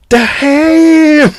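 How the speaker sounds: high, long drawn-out holler; mock leering whoop; joke punchline catcall. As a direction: stretch the word way out, high and lusty.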